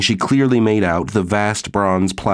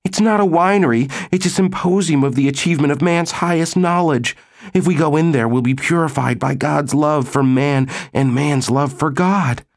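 high, fervent woman's voice; high-voiced zealous correction; fervent exclamation; 8 semitones higher than usual. A narrator's reading. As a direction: proclaim it with pious indignation, pitched well up. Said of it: "Maggie Walsh exclaimed".

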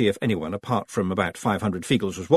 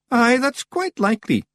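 high, puffed-up agreement; proud Rob Anybody; 4 dB louder than usual. A narrator's reading. Rob flattered by Daft Willie, swelling with pride. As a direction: accept the praise proudly, pitched up. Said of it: "swelling with pride".

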